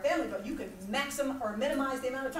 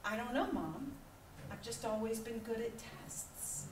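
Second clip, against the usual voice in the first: lightly voiced innocent son, softer; 3 semitones lower, 10 dB quieter than usual. Son answering mom innocently, a touch softer. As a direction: shrug it off innocently. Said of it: "he went".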